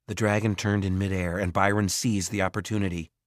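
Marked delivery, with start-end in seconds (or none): none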